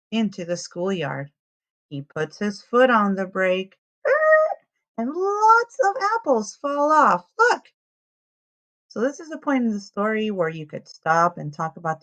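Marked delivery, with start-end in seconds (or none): 4.0-4.6 high squealing "er" of a braking truck; a loud, high-pitched sound effect of the truck stopping; squeaky brake noise. Acted. Truck brakes sharply in story. 5.0-7.7 much higher and louder, thrilled storyteller; showing kids the spilled apples, big excitement; excited. A narrator's reading. Apples tumble off the truck.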